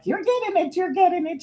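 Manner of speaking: high, excited mock cheer; imitating her. Mother cheering her caregiver on, high and excited. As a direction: squeal it up high like she did. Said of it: "She was like".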